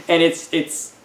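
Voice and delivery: ordinary talk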